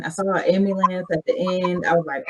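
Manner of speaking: ordinary talk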